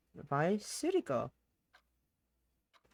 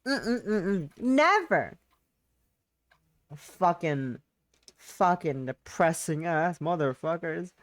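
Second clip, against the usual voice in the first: normal talking voice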